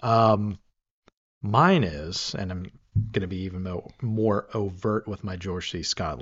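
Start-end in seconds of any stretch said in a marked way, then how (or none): none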